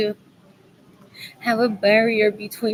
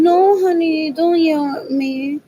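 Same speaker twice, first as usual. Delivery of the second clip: mock pleading wife; 5 semitones higher, 5 dB louder than usual. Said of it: "As in".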